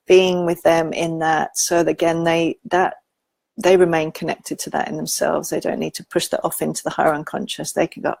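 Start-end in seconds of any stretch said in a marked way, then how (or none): none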